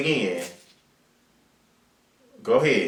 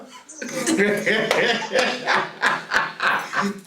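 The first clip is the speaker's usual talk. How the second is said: a big high burst of laughter; 8 semitones higher than usual. Real, not acted. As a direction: laugh hard at the caller. Laughing at New Orleans caller.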